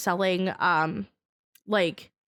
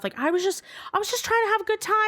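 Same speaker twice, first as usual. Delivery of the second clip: whiny excuse; 8 semitones higher, much faster than usual. Retold or imitated. Coworker's whiny excuse voiced.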